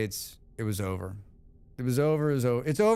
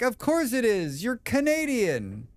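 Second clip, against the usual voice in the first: mock sarcastic; 9 semitones higher than usual. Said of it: "I'm like".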